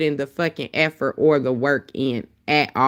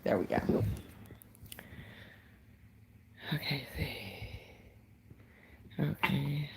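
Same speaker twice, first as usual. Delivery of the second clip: soft whisper to herself; hushed whisper, half to herself; whispered aside; 2 semitones lower, 18 dB quieter than usual. Real, not acted. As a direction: mutter it under your breath while fiddling with the phone. Muttering while plugging in phone.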